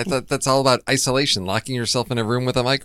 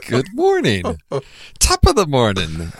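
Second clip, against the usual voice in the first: mock Irish accent; 3 semitones higher, 4 dB louder than usual. Co-host returning the greeting in a mock Irish accent. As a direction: answer back in a bad stage Irish accent. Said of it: "it's the Irish".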